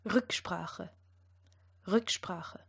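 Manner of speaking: "Rücksprache" with uvular R and throaty ch; back-of-throat R in "Rücksprache"; German R. Shown as a demonstration. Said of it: "And the second?".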